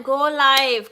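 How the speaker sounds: normal talking voice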